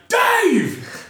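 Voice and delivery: high, drawn-out call of a name; imitated fan's high yell; retold crowd shout. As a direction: holler up to the stage, re-enacted. Calling to singer from the crowd.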